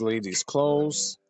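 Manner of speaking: ordinary delivery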